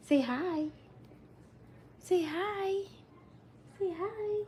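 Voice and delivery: put-on coaxing baby. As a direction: gently coax a baby to talk.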